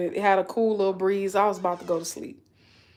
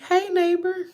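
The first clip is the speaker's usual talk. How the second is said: neighbor greeting; 10 semitones higher than usual. Retold or imitated. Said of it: "she was like".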